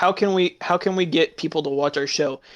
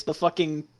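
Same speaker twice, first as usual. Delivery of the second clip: ordinary talk, nothing marked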